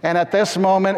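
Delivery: usual speaking voice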